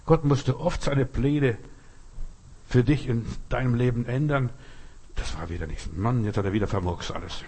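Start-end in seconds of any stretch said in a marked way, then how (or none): 5.1-7.4 low, softened grumble; a touch of weary exasperation, pitched down; mild exasperated grumble. Acted. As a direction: grumble under your breath, lightly exasperated. God grumbling over spoiled plans.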